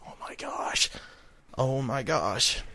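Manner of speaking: half-whispered, drawn-out amazement; hushed disbelief. Retold or imitated. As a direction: gasp it under your breath. Retelling hushed disbelief during call.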